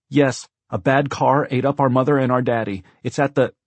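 put-on high, loud child's report; urgent little girl. A narrator's reading. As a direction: blurt out the emergency.

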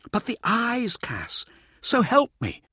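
high, slow, showboating lecturer; Mirza's voice. A narrator's reading. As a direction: relish every word like a showman. Narrator voicing Mirza.

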